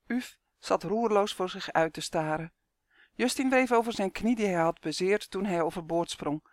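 ordinary delivery, nothing marked

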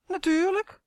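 lightly voiced boy, high and sure of himself; confident boy. Boy answers confidently in story. (zelfverzekerd jongetje)

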